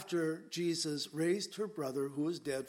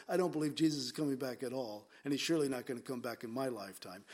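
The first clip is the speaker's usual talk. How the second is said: quick mock aside; 4 semitones lower, 3 dB quieter, much faster than usual. Voicing the unbelievers' real meaning.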